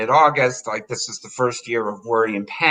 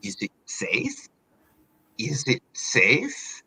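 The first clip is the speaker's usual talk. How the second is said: eerie whisper; 7 dB quieter, slower than usual. Retold or imitated. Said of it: "she would be like".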